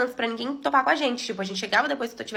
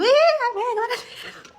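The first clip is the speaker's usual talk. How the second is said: high, loud churchgoers' amen; congregation shouting amen; 13 semitones higher, 4 dB louder, much slower than usual. Retold or imitated. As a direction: cry out amen like the whole congregation. (fiéis gritando amém, agudo e alto)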